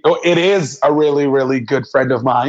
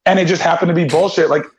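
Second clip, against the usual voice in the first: higher-pitched, exasperated retold rant; exasperated pleading; 7 semitones higher than usual. Telling off a friend who shared it unread, exasperated. Retelling his scolding of friend.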